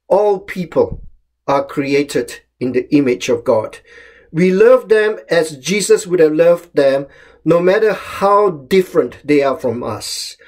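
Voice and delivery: ordinary talk, nothing marked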